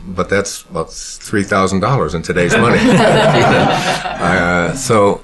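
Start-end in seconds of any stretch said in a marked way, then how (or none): 2.8-4.2 a sudden high burst of laughter. Real, not acted. Speaker laughing at his dime joke, high laugh. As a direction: laugh out at the punchline.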